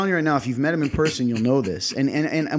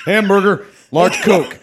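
higher, louder booming declaration; kingly order; 7 semitones higher, 10 dB louder, slower than usual. Retold or imitated. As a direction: proclaim the order like a king. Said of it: "he's got a kingly voice".

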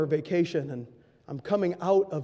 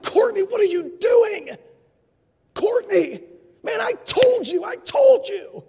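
put-on high, loud, slow scolding of the boy; scolding shout; 7 dB louder, slower than usual. Bus captain scolding Courtney, loud and slow. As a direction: let him have it, drawn out.

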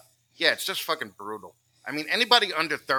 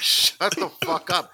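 mock dismissive snap; 6 semitones higher, 7 dB louder, slower than usual. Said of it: "You're just like".

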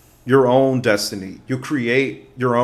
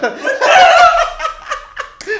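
high cackling laugh; 9 dB louder than usual. Real, not acted. Laughs at getting university wrong.

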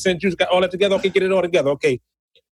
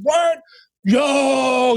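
excited shout; 9 semitones higher than usual. Retold or imitated. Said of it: "He said".

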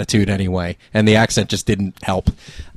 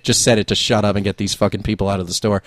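usual speaking voice

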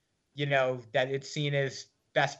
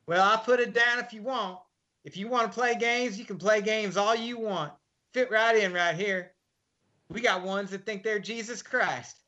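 higher, sarcastic scoffing nurse; mocking nurse; 7 semitones higher than usual. Nurse scoffing at the state senator answer, higher and sarcastic. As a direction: brush him off like a joker.

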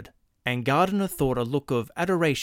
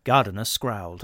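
ordinary delivery, nothing marked

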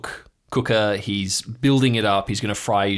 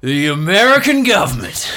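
put-on loud, higher cranky old bushman; raised, ranting hermit voice; Marv's rant; 8 semitones higher, 7 dB louder than usual. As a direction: bellow it like a paranoid old coot.

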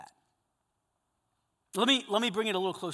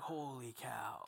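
hushed awe; 5 semitones lower, 13 dB quieter, slower than usual. Retold or imitated. Awe at the movie moment.

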